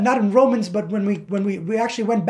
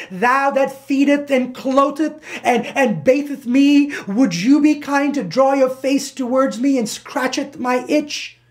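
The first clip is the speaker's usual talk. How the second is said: slow, high, loud archaic pleading; hammed-up scripture-style address; mock formality; 6 semitones higher, 4 dB louder than usual. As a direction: petition him with exaggerated ceremony. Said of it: "There's no formality involved with that".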